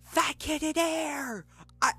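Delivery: put-on high kid's taunt, slowed; mock yelled kid. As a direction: mimic a kid yelling across the street. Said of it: "I heard".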